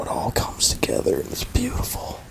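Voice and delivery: hushed, breathy hush for the big finish; dramatic whisper. Acted. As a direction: whisper it like a secret revelation.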